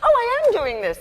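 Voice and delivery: excited realization. Retold or imitated. Actress recalling her reaction to getting the script, excited realization. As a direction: relive the moment it dawned on you. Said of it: "and I thought".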